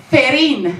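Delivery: mock the family saying "Ferin", lisping the start and trilling the R; "Ferin" with a lisp and a rolling R; lisped name with rolled R. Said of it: "put a lisp and a rolling R in my name".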